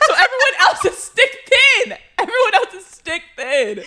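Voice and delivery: high, laughing disbelief. Real, not acted.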